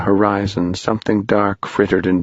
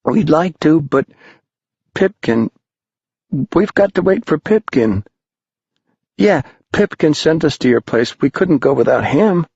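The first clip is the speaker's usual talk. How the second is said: put-on anxious boys; 8 semitones higher than usual. Boys insisting they wait for Pipkin, high and anxious. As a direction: plead to wait for their friend.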